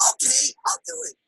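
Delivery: high, soft squeal of agreement; hammed-up eager shopper in a small high voice; very excited. Mary agrees to try the diet, very excited. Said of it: "She's very excited".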